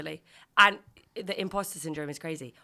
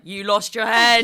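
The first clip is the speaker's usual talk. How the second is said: loud, slow, high mock alarm; hammed-up gasp at the lost head; mock shock; 6 semitones higher, 15 dB louder, slower than usual. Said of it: "but you're like".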